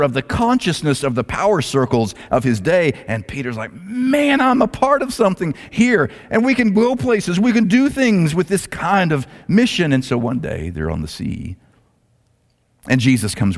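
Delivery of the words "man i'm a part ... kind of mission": high, thrilled exclamation, stretching the first word; excited. Retold or imitated. Peter marvels at joining Jesus's mission, excited.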